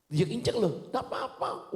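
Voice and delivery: loud, high-pitched retort; animated mock quarrel; acted argument. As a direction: act out a heated comeback, voice jumping up. Acted back-and-forth argument.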